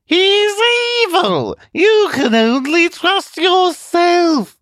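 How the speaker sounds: shrill talking television. Acted. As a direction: squeal it like a scheming machine.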